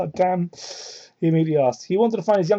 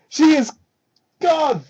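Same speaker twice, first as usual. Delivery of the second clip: laughing cry; 8 semitones higher, slower than usual. Real, not acted. Laughing-crying at the fic.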